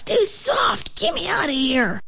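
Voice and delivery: very high panicky squeal; squealed high-pitched protest; alarmed cry. A narrator's reading. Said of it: "cried Dusty Locks".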